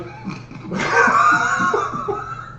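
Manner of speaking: sudden high burst of laughter; squealing laugh at own blunder. Real, not acted. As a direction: crack up mid-sentence at yourself. Mid-joke fumble, bursts out laughing.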